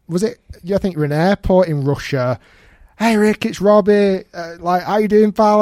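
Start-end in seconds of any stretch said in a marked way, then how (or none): 3.0-5.6 higher, louder imitation of a cheery caller. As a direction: hail a mate loudly over a video call. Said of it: "pops up you in a".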